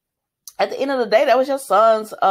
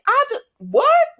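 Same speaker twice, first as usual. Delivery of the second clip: much higher, louder startled what; 4 dB louder than usual. Retold or imitated. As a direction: jolt up in disbelief. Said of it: "And Nina was like".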